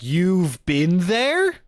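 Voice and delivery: high, loud, drawn-out disbelieving question; stretched, incredulous outburst rising high; incredulous reaction. Retold or imitated. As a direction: reenact stunned disbelief, pitch shooting up. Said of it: "because I was like".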